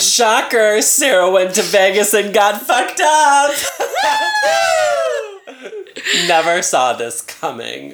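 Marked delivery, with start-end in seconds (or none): 0.0-3.5 loud high mocking shout; excited shout. Real, not acted. Friend mocks her drunk story. 3.6-6.2 loud high shrieking laugh. Real, not acted. Loud laugh after the joke.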